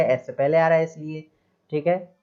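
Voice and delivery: normal talking voice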